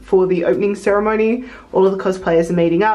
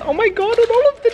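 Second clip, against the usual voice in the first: high excited gushing; excited delight; 12 semitones higher, 4 dB louder, faster than usual. Real, not acted. Vlogger spotting cute table decorations, high excited gush.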